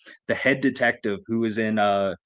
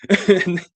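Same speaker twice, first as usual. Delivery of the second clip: 1 laugh; 7 semitones higher, 6 dB louder than usual. Real, not acted. Laughing at own story.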